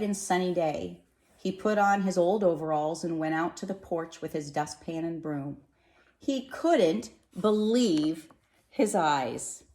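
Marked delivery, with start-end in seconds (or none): 6.2-9.6 slow, wondering narration; amazed. A narrator's reading. Narrator revealing the decorated porch, slow and amazed. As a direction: draw it out in disbelief.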